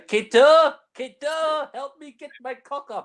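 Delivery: mock desperate cries for help; hammed-up Clouseau yelling for his servant; calling out.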